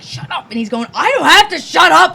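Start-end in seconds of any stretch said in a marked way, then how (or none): 0.0-0.5 higher, softer mock command; retold hushed snap. Woman retelling her fight with her partner, hushed telling-off. 0.9-2.1 higher, louder retort, imitating an argument; mock defiant yell. Woman imitating her partner talking back, loud defiance.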